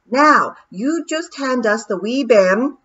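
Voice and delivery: loud, slow broad Scottish call; shouting Scots pixie. Acted. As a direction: call out across the boat in Scots. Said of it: "shouted Rob Anybody from the stern".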